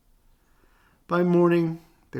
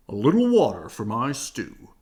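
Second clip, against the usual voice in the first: Papa Bear; 7 dB louder than usual. Acted. Papa's voice, louder.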